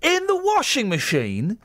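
high, slow, scandalised voice; mock outrage. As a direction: ham up the shocked reaction. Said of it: "so we go".

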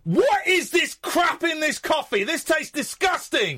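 horrified coffee complaint; disgusted outburst. Real, not acted. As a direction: spit it out like the coffee is foul. Host tastes oat milk coffee.

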